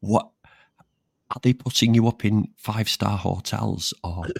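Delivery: usual speaking voice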